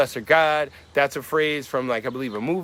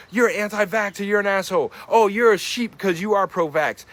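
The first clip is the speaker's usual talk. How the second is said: louder, higher angry yelling at each other; mock shouting match; 5 semitones higher, 5 dB louder than usual. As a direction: hurl the insults like a heated argument. Acted quarrel, people yelling.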